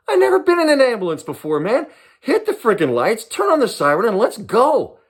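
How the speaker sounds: put-on gleeful kid in his first ambulance; excited. Thrilled patient urging ambulance driver.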